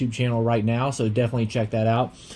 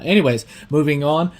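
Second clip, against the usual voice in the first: ordinary talk, nothing marked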